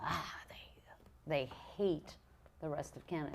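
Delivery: hushed conspiratorial whisper; whispered as if sharing a secret. Real, not acted. Conspiratorial aside about Montreal.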